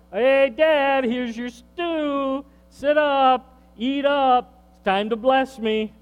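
loud, high, pushy son presenting the stew; hammed-up eager son; eager Esau. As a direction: barge in grinning, demanding the blessing. Esau voiced eagerly bringing stew.

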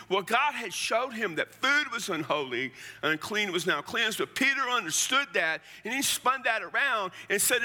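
rising fervent sermon delivery; preaching at full force. Acted. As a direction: preach it, pushing the point home to the congregation. Preaching builds in intensity.

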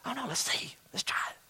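hushed whisper; whispering student. Retold or imitated. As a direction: let it slip out secretly. Students scheming quietly.

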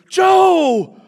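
loud, high, slow delighted greeting; joyful welcome. Shown as a demonstration. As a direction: welcome him like a dear old friend. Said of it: "So not just B J".

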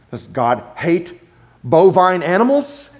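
ordinary talk, nothing marked

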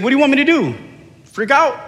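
high-pitched acted comeback; mock protesting retort. Friend's retort in acted quarrel.

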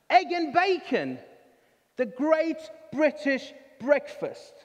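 high, slow proud chicken voice; a hen proudly reciting the slogan, slowly. Acted. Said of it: "the chicken turns around to the pig and says".

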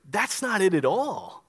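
ordinary talk

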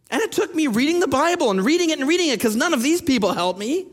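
normal talking voice

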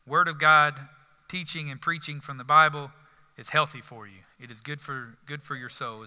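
normal talking voice

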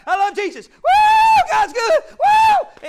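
mocking a believer who's briefly on fire for God; mock fired-up shout. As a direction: yell like an overexcited new convert. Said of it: "get on fire for God".

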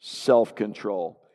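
Self-control said slowly and low, each syllable drawn out; slow low unison lead-in for the church; slow drawn-out call. Shown as a demonstration. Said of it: "Say that with me".

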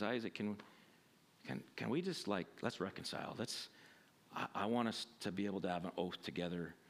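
low, soft, earnest plea; conciliatory Abimelech. Retold or imitated. Abimelech asking Isaac to reconcile: soft, low, conciliatory. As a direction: make a gentle peace offer, voice dropped and quiet. Acting Abimelech's humble peace offer.

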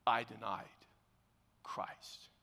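low, hushed, halting confession; crushed realization. Retold or imitated. Acts out Peter's crushing realization.

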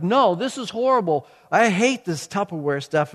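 mock-indignant outburst, starting high; retold ranting. Husband ranting on about Tupperware, mock exasperation. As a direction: replay a rant that will not stop. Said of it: "I couldn't stop my mouth".

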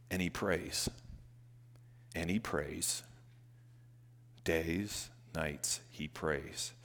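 soft, low and slowing; hushed refrain. Acted. Pastor softly stressing Nehemiah's prayer, hushed. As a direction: let it sink in quietly.

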